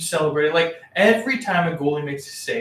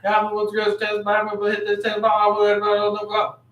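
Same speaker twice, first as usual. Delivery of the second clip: cocky jock voice; 5 semitones higher than usual. Pretend. Mocking a cocky tennis player.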